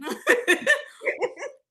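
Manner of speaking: loud high laugh; big high laugh; burst of laughter. Real, not acted. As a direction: laugh out hard at the memory. Laughing at own story.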